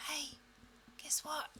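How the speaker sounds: whisper. Pretend. Body whisper again.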